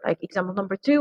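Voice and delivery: ordinary talk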